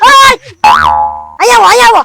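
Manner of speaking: high, loud calling shout; angry shout. Acted. Shopkeeper shouts after the man who pushed him, loud. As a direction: yell to make him come back.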